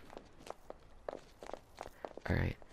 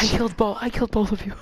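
high, loud, triumphant; excited; 8 semitones higher, 4 dB louder than usual. Real, not acted. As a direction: crow about the win. Triumphant after double kill.

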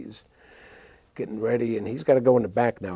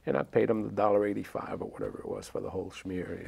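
usual speaking voice

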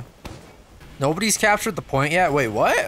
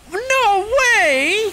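very high, loud, stretched cry; high-pitched dramatic protest; shrieked disbelief; 5 dB louder than usual. Pretend. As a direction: screech in mock disbelief. Disbelief in game.

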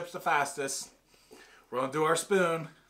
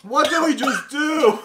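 laughing in disbelief; 11 semitones higher, 10 dB louder, slower than usual. Real, not acted. After eating spicy chip.

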